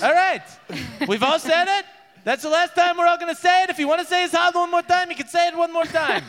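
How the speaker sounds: high, exasperated royal voice; the king flusters, pitched high; flustered king. Acted. King scolding guards about the prisoner, high and flustered.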